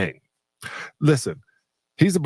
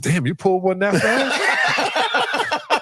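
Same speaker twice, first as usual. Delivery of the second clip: laughing tease; 12 semitones higher, much slower than usual. Retold or imitated. Teasing Kobe leaving early.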